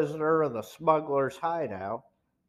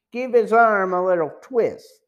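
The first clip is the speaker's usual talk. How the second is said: a hint of a thug, higher and louder; lightly voiced henchman urging rough treatment; louder, pitched-up goon suggestion; smuggler henchman; 5 semitones higher, 7 dB louder than usual. A narrator's reading. Smuggler urges roughing up the prisoner.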